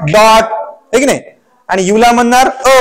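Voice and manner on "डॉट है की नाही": ordinary delivery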